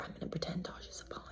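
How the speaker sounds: quick, barely audible whispered aside; inner-thought whisper. Retold or imitated. Contestant's private scheme voiced to viewers, whispered. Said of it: "in my head, I'm like".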